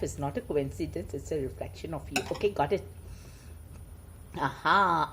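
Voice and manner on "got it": ordinary delivery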